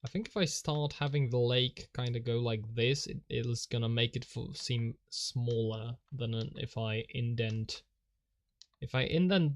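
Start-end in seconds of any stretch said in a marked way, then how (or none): none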